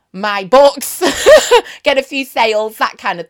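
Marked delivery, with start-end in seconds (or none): 1.1-1.8 high laugh. Real, not acted. Laughs at book plug.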